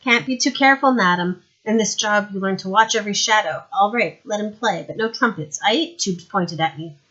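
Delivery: pleased with his own caution; smug paranoid lord. Acted. Lord Winder explaining to Madame, smug and loud.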